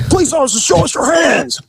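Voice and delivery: high commanding shout; high shouted police order. Retold or imitated. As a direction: bark it as officers storming in. Officers kick door in.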